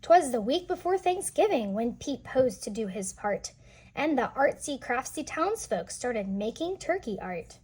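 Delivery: lively storybook reading voice; read-aloud narration. A narrator's reading. Teacher reading a picture book to children, lively storytelling. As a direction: read the rhyme to the kids with bounce.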